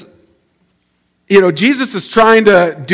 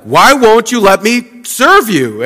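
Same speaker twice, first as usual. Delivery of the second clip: frustrated plea; 7 semitones higher, 5 dB louder, slower than usual. Retold or imitated. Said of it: "you get kind of frustrated".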